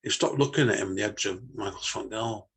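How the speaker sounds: usual speaking voice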